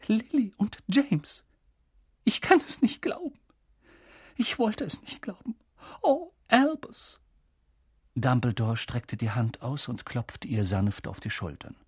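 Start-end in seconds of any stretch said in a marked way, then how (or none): 0.1-7.2 breathless shock. A narrator's reading. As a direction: catch the breath and lament in a thin high voice. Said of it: "Professor McGonagall stockte der Atem". (mit stockendem Atem, fassungslos)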